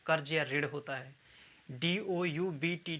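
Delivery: ordinary delivery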